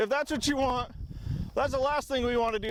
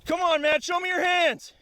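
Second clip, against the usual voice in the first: shouted surrender demands; 5 semitones higher than usual. Real, not acted. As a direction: call out the order firmly. Commanding the downed suspect.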